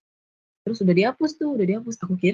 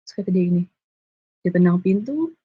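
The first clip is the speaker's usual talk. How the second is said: normal talking voice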